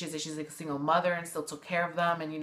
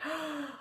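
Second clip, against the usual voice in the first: sharp gasp; 9 semitones higher, 9 dB quieter than usual. Real, not acted. Reacting to the twist.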